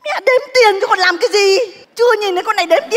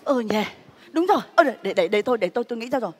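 muttering to herself; 7 semitones lower, 7 dB quieter, faster than usual. Acted. Mother thinking aloud, muttering.

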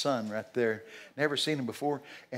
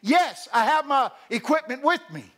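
higher-pitched, carefully spoken quote; imitating perfect English; 11 semitones higher, 7 dB louder than usual. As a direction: mimic a woman answering in perfect English. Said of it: "in perfect English".

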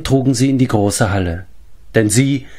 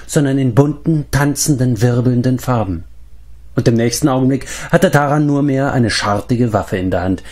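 usual speaking voice

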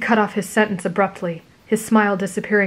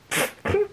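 light laugh; 12 semitones higher, 4 dB quieter than usual. Acted. Traveler laughs unexpectedly.

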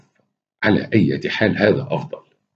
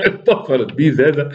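laughing through the words, higher; 6 semitones higher than usual. Real, not acted. Narrator laughing at Rashid's panic, real laugh. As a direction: crack up while reading it.

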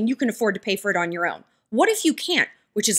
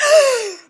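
high excited mock gasp; sharp delighted intake of breath; 13 semitones higher, 6 dB louder than usual. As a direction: gasp with delight like he's thrilled. Excited fiancé gasping.

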